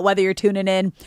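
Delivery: usual speaking voice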